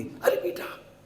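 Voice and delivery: soft, tender mother-in-law; quiet, affectionate warning; lovingly. Retold or imitated. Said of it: "इतने प्यार से ही बोलती".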